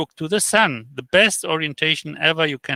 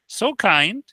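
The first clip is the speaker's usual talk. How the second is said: usual speaking voice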